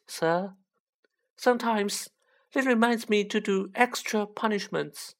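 high squeaky elf voice; high-pitched Dobby. A narrator's reading. Elf used to punishing himself, high-pitched. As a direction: explain it earnestly in a high squeak. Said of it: "in a high-pitched voice".

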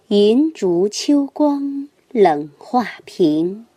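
slow, measured poem reading; poem recitation. Acted. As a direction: recite it slowly and evenly. Reads a classical poem.

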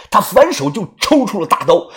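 plain reading voice

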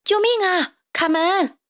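high, urgent shout; panicked yell for help; crying for help. Acted. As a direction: call a friend to the rescue. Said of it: "贝里奥呼喊着".

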